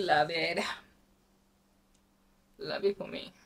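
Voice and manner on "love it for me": unvoiced soft whisper to herself; real self-pleased whisper; whispered aside. Real, not acted. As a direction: breathe it to yourself.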